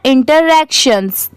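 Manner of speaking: "Interactions" run together as one quick word; at speed. Shown as a demonstration. Teacher showing the speedy reading, "interactions" quick and joined. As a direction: read "interactions" swiftly, with no gap between parts. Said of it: "इसे speed में कैसे पढ़ते हैं".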